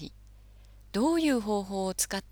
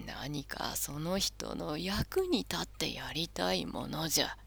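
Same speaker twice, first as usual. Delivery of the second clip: put-on slow whispery old man; firm but gentle elder's hush; creaky wise old man; 3 semitones lower, 7 dB quieter than usual. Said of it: "きっぱりと言い返しました".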